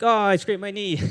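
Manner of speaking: put-on crying kid. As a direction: play the kid who scraped his knee, lightly whiny. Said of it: "starts crying, like".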